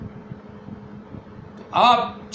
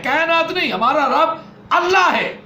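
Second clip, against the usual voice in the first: preaching emphasis; 7 semitones higher, 4 dB louder than usual. Acted. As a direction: stretch it out as a declaration.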